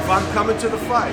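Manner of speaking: loud, high resolve; loud second take of the vow; firm vow repeated. Retold or imitated. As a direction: hammer the vow home.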